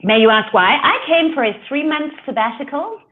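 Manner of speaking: ordinary delivery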